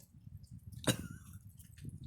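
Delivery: harsh hacking cough after inhaling smoke; real choking cough from cigar smoke; coughing fit. Real, not acted. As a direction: cough hard, lungs burning.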